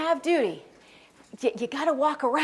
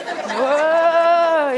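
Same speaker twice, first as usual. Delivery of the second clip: big drawn-out mock whoa, louder; hammed-up whoa; 4 semitones higher, 8 dB louder than usual. Kids react to the teacher's signal with a big mock whoa. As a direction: stretch the whoa out like awed kids.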